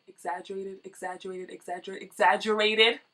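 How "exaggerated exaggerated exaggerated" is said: word repeated as a fading, distant, much softer echo; echo effect. Acted. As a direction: let it ring out like an echo in a hall. Echoed emphasis on one word.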